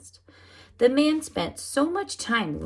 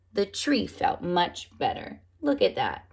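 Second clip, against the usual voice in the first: ordinary delivery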